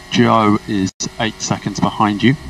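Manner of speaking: usual speaking voice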